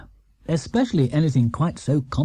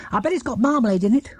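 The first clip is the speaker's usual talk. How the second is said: put-on Jonathan, eager boy; 9 semitones higher, faster than usual. Jonathan voiced in story.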